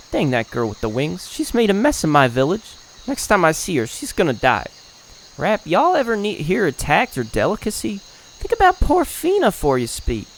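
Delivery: indignant country-folk grumbling; lightly hammed-up rustic villager, worked up; folksy villager. Villagers grumble about the winged girl, folksy and indignant.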